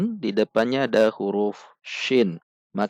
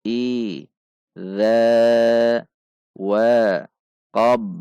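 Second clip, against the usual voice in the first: dieja lambat sepotong demi sepotong dengan jeda; panjang pendek bacaan; much slower than usual. Shown as a demonstration. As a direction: tahan dengung dan mad, pendekkan yang lain. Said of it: "perhatikan panjang dan pendek bacaan". (bacaan tartil terpisah-pisah)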